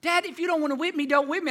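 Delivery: put-on high, bright-eyed kid bargaining with his dad; pleading child. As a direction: plead high like a clever little boy getting out of a whipping. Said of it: "So you know what I said?".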